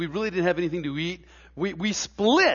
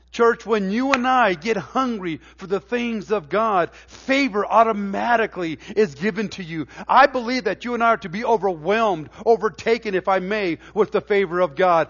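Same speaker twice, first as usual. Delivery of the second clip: louder, rising preacher's cadence; fervent preaching; 2 semitones higher, 5 dB louder than usual. Acted. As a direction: drive each line home to the church. Preaching builds to full force.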